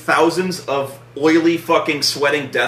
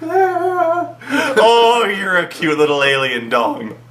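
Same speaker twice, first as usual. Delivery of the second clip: high cooing to a pet; hammed-up doting baby voice; baby talk; 6 semitones higher, much slower than usual. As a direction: coo at it like a puppy. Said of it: "He's like".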